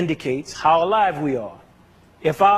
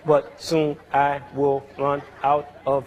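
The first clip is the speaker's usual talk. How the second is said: slow, lower, unbroken monotone with no accents; run-on speech with no rests; 3 semitones lower, slower than usual. Shown as a demonstration. Teacher to class, showing speech with no rests: slow even monotone. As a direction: say it all in one breath with no pauses or stress. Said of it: "what if we spoke like".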